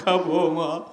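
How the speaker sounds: from a call down to a trailing sung sob; grieving lament. Acted. Son at mother's grave.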